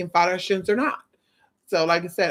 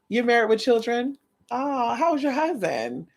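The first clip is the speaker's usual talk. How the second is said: sarcastic; 5 semitones higher than usual. Pretend. Mock polite sarcastic question.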